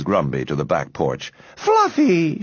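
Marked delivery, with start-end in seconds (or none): 1.6-2.4 high-pitched coo to a pet. A narrator's reading. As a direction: coo fondly at your cat. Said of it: "she cooed".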